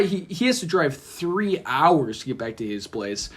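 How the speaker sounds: ordinary delivery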